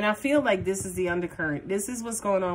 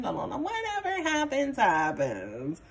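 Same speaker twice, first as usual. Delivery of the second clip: carefree singsong lilt, pitched up high on la la la; mock happy-go-lucky; 7 semitones higher than usual. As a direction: brush it all off with a mocking carefree sing-song. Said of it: "you pretend to be this happy-go-lucky".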